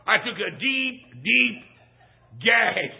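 comic retching on the last word; mock gag. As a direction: act out choking on the smoke. Choking on coffee smoke.

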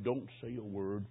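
low, soft bedside hush; hushed pastor. Retold or imitated. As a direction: say it softly, keeping it private.

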